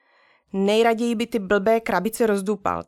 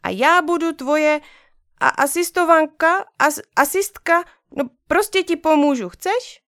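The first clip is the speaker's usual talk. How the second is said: high, louder little sister voice; eager small girl stumbling over a word; 6 semitones higher, 4 dB louder than usual. Acted. Sister offers help, high and eager.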